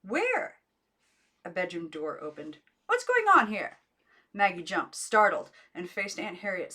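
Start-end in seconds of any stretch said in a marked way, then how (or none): 0.0-0.6 high, rising, frightened call; anxious high call; shrill call. A narrator's reading. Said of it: "rang shrill and trembling". 2.9-3.8 high, loud demand; an aunt woken by noise, snapping; high loud irritation; annoyed aunt. A narrator's reading. Aunt roused from bed.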